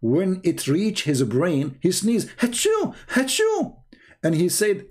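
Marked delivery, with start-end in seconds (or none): 2.4-3.8 high, hammed-up sneeze sound; mock sneeze. Speaker showing how Adam sneezed, a mock sneeze. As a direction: act out a big sneeze, pitched up.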